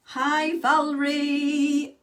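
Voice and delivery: loud, high, slow live hello; loud slow hello to a viewer joining the live; loud greeting. Real, not acted. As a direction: call out a drawn-out hello to a viewer.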